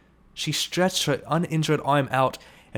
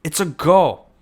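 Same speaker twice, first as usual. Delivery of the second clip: loud, high shout; 5 semitones higher, 9 dB louder, slower than usual. Acted. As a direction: lead singer cuts through the panic. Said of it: "who shouted".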